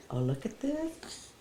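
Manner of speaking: high rising coo. Real, not acted. Talking to baby.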